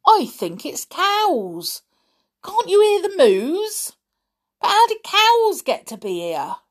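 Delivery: high, incredulous questioning; amazed. A narrator's reading. Mister Stick puzzles over mooing underground, amazed. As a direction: swoop up high in bafflement.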